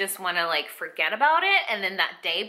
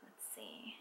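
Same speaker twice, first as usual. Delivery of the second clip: voiceless, very soft whisper; whispering to herself; 13 semitones lower, 27 dB quieter than usual. Real, not acted. Mother checking the appointment date, whispering to herself. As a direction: whisper it privately while checking.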